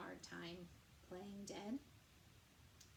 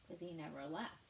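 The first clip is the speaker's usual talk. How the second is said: plain reading voice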